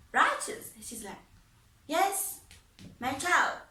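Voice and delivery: high, loud upbeat father voice; father's hearty greeting. Retold or imitated. Father checking on his recovering daughter, loud and high.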